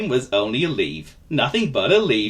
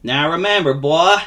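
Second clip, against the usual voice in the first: higher, drawling folksy voice; friendly vagabond; 6 semitones higher, 5 dB louder than usual. Acted. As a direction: confide kindly in a nervous little chap. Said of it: "he said to Mister Nervous".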